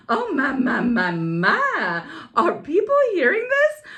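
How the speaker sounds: high, scandalised prim lady voice; hammed-up shocked prude; pearl-clutching lady. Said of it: "clutching at my pretend pearls".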